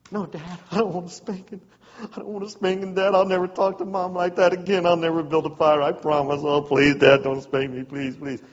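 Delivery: hammed-up begging not to be spanked. Boy begging his dad to spare him a spanking. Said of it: "He enjoyed my begging".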